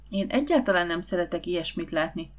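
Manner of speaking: ordinary delivery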